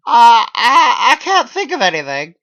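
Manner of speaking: squeaked out in a loud teary panic; high teary clarification. A narrator's reading. Held at gunpoint, answering Clyde in a high loud teary voice. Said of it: "I clarify".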